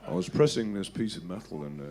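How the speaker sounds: low, quiet, still Brummie monotone. Retold or imitated. Guitarist recalling the factory accident, low and flat Brummie. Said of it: "my [name] impression".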